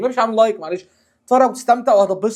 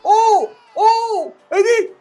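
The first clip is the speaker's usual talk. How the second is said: stunned gasp; 11 semitones higher than usual. Real, not acted. Shocked reaction to an own goal.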